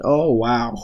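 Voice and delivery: usual speaking voice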